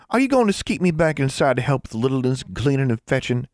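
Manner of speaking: plaintive little sister with a rural twang; upset country girl. A narrator's reading. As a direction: complain like a hurt country girl. Upset child voiced in story.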